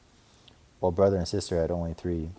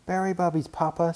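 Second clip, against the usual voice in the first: put-on little boy voice; 10 semitones higher, 3 dB louder than usual. A narrator's reading. Brother cub voice.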